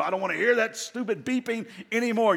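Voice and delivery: mock frustrated yell at the beeping alarm. Homeowner yelling at a beeping smoke alarm, mock frustrated. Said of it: "You're yelling at an inanimate object".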